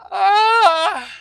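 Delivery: big high yawn breaking out; loud yawn. Real, not acted. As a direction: yawn out loud, mid-sentence. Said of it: "excuse me while I yawn".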